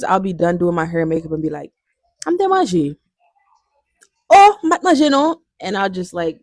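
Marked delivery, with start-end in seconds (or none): 2.3-5.4 high-pitched, exaggerated self-quote; playful high "oh!" of realization; mock sudden realization. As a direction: act out suddenly remembering, voice leaping up. Acts out realizing she hasn't eaten.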